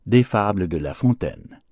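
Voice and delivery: ordinary delivery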